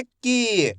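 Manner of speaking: की with a long held ee; stretched pronunciation. Shown as a demonstration. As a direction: stretch the vowel of की, falling at the end. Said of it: "मैं इसका खींच के उच्चारण कर रहा हूँ".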